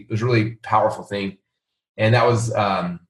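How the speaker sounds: ordinary talk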